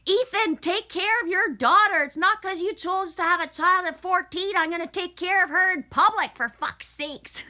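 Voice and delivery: a mom pranking her stepson with a public shout; loud mock scream. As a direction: yell across the store in mock outrage. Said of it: "I screamed really loud".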